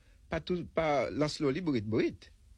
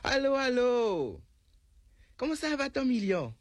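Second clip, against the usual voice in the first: calling out; 9 semitones higher than usual. Acted. The little lady calls out to Gros Million. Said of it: "Il crie".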